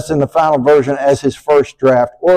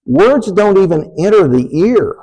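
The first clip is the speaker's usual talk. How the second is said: ordinary talk, nothing marked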